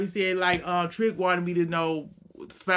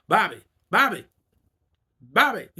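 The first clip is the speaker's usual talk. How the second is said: calling out; 3 semitones higher, 8 dB louder than usual. Retold or imitated. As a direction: call Bobby across the room. Trick calling out for Bobby.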